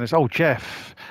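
usual speaking voice

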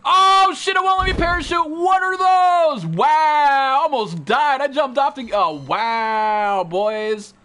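loud, high-pitched yelling in shock; panicked shout. Real, not acted. Streamer nearly dies when the parachute won't open, panicked shout.

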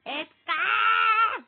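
a very loud, high scream of the name; furious yell. Real, not acted. Yelling at the game. (öfkeyle bağırılan isim)

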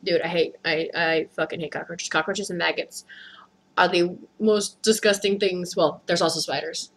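quiet, low-pitched grumble; subdued disgust. Real, not acted. Storyteller confessing hatred of cockroaches, subdued disgust. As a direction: say it low and soft, worn down by disgust.

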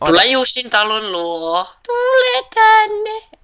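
very high, held calling tone; playful luring call. Pretend. Player lures creeper to friend's house, playful calling. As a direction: call it over in a long, high coo.

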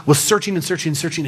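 ordinary delivery

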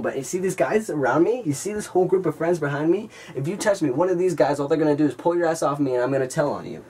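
ordinary talk, nothing marked

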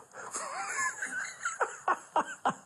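high, breathless burst of laughter. Real, not acted. Huge laugh.

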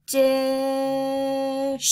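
loud drawn-out "Dish"; the blend "Dish" for the class; blended word. Shown as a demonstration. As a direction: stretch "Dish" slowly. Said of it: "put those two together".

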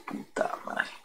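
hushed curse whispered under the breath; mock-scared whisper to himself. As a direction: whisper it in sudden dread. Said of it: "Puta, y yo dije".

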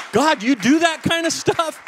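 high-pitched, amazed disbelief; astonished. Retold or imitated. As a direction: gasp it out to God, stunned. Amazed reaction to the healing.

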